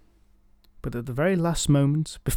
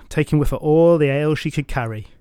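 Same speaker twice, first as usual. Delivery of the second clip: plain reading voice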